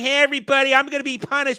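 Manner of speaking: high, whiny mock crybaby announcement; hammed-up fake crying complaint, pitched high; mock whining. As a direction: whine it like a crybaby broadcasting doom. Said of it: "Cloud crying".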